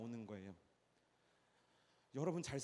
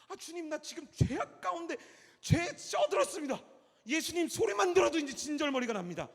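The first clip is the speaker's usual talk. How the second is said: desperate sinner's prayer; 13 dB louder than usual. Acted. Sinner's despairing prayer voiced.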